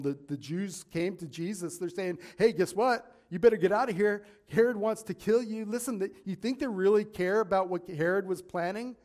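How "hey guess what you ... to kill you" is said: mock urgent warning. Pharisees warn Jesus, acted.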